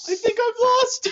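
very high, slow squeaky voice. Pretend. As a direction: squeak it out in falsetto. Dramatic whine during Jenga.

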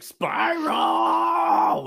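high, squealing shout; excited shout. Retold or imitated. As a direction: squeal the title out. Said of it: "She's like".

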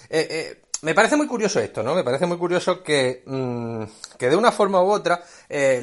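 ordinary delivery

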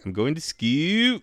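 high, slow mock-British child; softly mimicked British schoolgirl; little British girl. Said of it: "and we say it".

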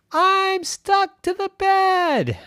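high, slow, drawn-out yell; shouted. Acted. Irwin yelling to his parents that he's stuck, slow high yell. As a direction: wail it down the stairs. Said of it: "And he shouted".

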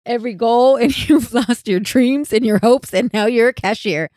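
sarcastic, laughing mock sympathy. Customer mocking the cashier with fake pity. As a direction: roast her with fake pity. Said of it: "I started clowning on her".

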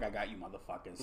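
low, hushed aside; quiet, low-pitched gotcha; retold private gloat. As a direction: say it under your breath. Retelling his private triumph over opponents.

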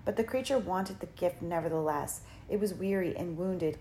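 ordinary delivery, nothing marked

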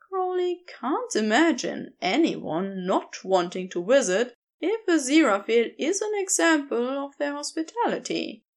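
ordinary delivery

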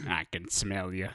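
slow it down and sink into a deep movie narrator; Morgan Freeman impression. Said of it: "talking in a Morgan Freeman voice".

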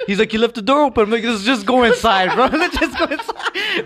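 high, slow, coaxing through laughter; laughing coax. Retold or imitated. Friend laughing, urging Dre to go inside. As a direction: plead to go in while cracking up. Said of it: "But I'm like".

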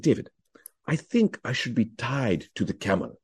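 Omar's courteous voice, lightly done; Omar impression. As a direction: plead politely to the director as Omar. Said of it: "I'm doing an impression of Omar".

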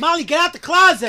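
high, loud panicked yell; shout. Pretend. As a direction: shout it at the closet. Yelling at ghost in story.

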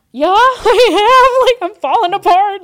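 very high, loud, drawn-out mock breakdown; hammed-up wail. Woman admitting her bad day to kind stranger, comically wailing. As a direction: wail it out with a laugh inside.

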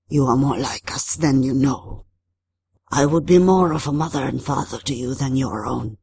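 waterlogged, deliberate spirit voice; Tarabusao. A narrator's reading. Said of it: "hoarse, waterlogged, speaking in an accent so thick".

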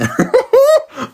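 a high, loud, shrieking laugh; burst of laughter. Real, not acted. Fan cracking up while retelling the episode, high laugh. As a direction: breaks into a squealing laugh.

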